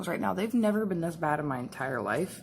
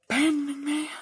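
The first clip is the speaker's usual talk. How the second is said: higher, hammed-up wail of abandonment; mock dramatic lament; 7 semitones higher than usual. Real, not acted. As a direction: play the betrayed friend, over the top. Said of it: "She's abandoning me".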